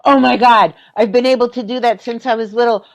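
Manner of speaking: replaying the thrill of a childhood discovery; excited inner cry. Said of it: "what I was saying was".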